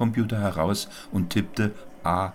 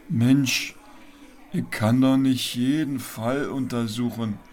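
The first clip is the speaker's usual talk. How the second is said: Max character voice; 7 semitones higher than usual. A narrator's reading. Max voiced, pitched high.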